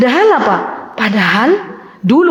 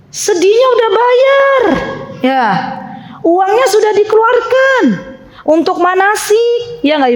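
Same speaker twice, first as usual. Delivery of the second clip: whiny complaint; 8 semitones higher than usual. Retold or imitated. Mocking worldly complaint.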